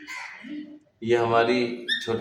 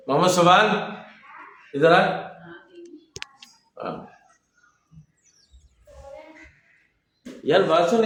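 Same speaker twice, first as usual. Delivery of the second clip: calling out; 9 semitones higher than usual. Real, not acted. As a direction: call someone from another room. Calling out to someone away.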